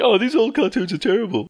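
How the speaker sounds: high sneering complaint; hammed-up high disdain; mock snooty critic. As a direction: scoff like a snob. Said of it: "It's like".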